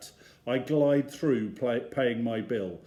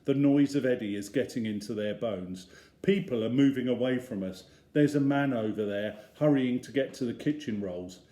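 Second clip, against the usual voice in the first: ordinary delivery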